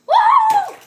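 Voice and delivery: high excited whoop; loud whoop. Acted. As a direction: whoop as you jump. Jumping off the edge.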